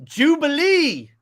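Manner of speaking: drawn-out, high, loud cheer; excited reveal. Real, not acted. Host revealing a cameo to co-host, excited and drawn out. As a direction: stretch the name out in delight.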